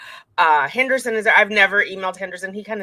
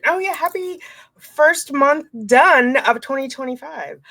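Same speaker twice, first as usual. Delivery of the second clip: excited; 9 semitones higher, 6 dB louder than usual. Real, not acted. Sudden cheerful aside.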